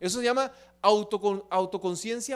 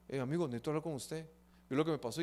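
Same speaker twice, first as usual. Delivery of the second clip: lower, softer, fast mock man's plea; imitating a man confiding; 6 semitones lower, 8 dB quieter, faster than usual. Man asking a friend to talk, low mumbled mock plea. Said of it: "un hombre que le diga".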